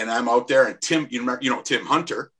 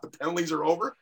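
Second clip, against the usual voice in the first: normal talking voice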